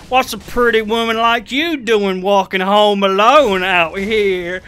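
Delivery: loud, drawn-out cowboy role-play; cowboy drawl. Retold or imitated. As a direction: put on a hammy cowboy twang. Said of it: "I was role-playing as a cowboy".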